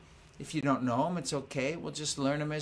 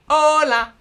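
loud call; 12 semitones higher, 15 dB louder than usual. Shown as a demonstration. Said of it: "I'll sing 'em".

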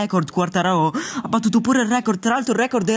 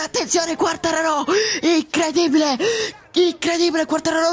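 excited shout; 6 semitones higher than usual. Real, not acted. Pole lap finishes.

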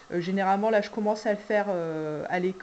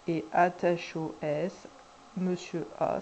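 ordinary talk, nothing marked

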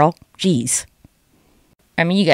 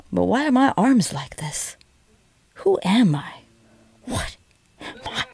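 soft, slow, bewildered monster; little Godzilla; 3 semitones higher, 6 dB quieter, slower than usual. Acted. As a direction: lament like a small confused monster. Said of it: "this is my little Godzilla".

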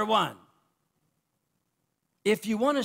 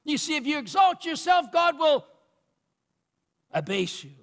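building, rhythmic pulpit cadence rising in pitch; fervent preacher climbing higher; full-force preaching; 4 semitones higher, 4 dB louder than usual. Real, not acted. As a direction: build the list to a peak.

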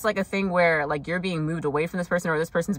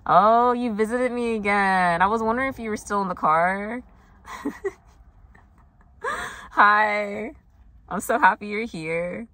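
higher, sweet talk to a little creature; fond greeting; 4 semitones higher than usual. Real, not acted. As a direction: coo a delighted welcome to a tiny visitor. Fondly greets a visiting spider.

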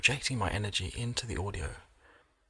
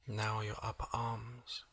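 soft, slow relaxation voice with long pauses; hushed, unhurried body-scan meditation; guided meditation; 6 dB quieter than usual. Acted. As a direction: gently guide the listener into calm, leaving space between phrases.

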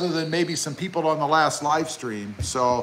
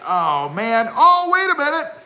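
mock sudden realization; 9 semitones higher than usual. Owner realizing a newer truck came out, high mock surprise. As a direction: play the buyer who just spotted the new model. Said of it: "and you're like".

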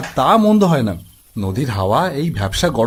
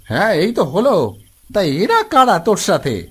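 grandpa answering, pitched up; a hint of an inquisitive grandpa; lightly voiced village grandpa; 4 semitones higher than usual. Plays the grandpa character. (দাদুর গলায় হালকা অভিনয়)

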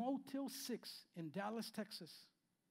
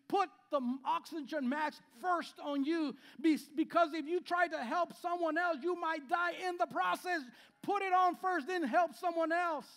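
raised, urgent announcement; flight safety warning; 9 semitones higher, 9 dB louder than usual. Retold or imitated. Pastor urging the congregation, loud flight-safety warning. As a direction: shout the warning to save yourself first. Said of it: "they always tell you".

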